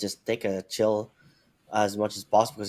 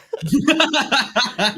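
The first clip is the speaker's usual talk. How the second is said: bright giggling laugh; high burst of laughter; 13 semitones higher, 8 dB louder than usual. Real, not acted. Laughing at own hiccup joke.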